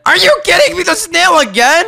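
high, squealing are you kidding me; shrieking in disbelief at the pet; outraged disbelief. Real, not acted. To friends over a bad pet draw, squealing disbelief. Gets the snail pet again.

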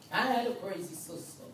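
ordinary talk, nothing marked

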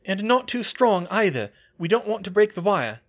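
high, loud instruction; ordering Hermione. A narrator's reading. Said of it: "Hermione ordered".

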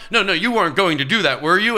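high, loud reproach; self-scolding. Retold or imitated. Confronting himself about dinner, a loud reproach. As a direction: scold yourself sharply. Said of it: "sort of aggressive with myself".

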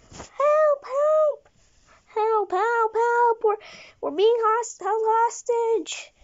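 very high falsetto screams for help; fake girl hostage. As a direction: shriek like a helpless girl hostage. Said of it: "pretending to be a girl".

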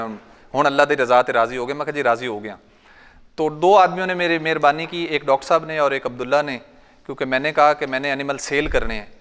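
ordinary talk

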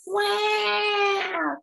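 high loud wow; Froggy impressed; amazed wow. Acted. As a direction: marvel out loud. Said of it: "said Froggy".

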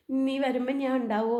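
normal talking voice

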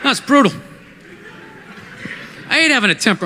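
ordinary talk

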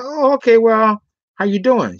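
acting out a suddenly interested hello; retold interested greeting. Man learns she's a manager, retold interested greeting.

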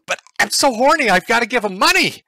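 high, loud, excited fanboy; thirsty viewer voice. Pretend. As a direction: gush like a desperate donor. Acting a thirsty donating viewer.

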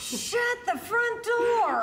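slow, high, loud disbelief; shocked shout. Real, not acted. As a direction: yell it in total surprise. Stunned she is high.

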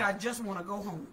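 a lightly voiced, low and soft soldier's plea. Preacher quoting the soldier on the bus, soft and low. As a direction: murmur it low like a tired young soldier.

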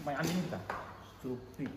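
ordinary talk, nothing marked

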